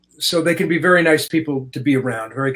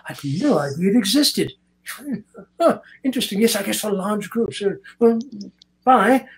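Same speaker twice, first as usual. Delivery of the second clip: curious Anthony Hopkins; 7 semitones higher than usual. Retold or imitated. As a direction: play him amazed and curious, drawing the words out. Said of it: "he was looking at it".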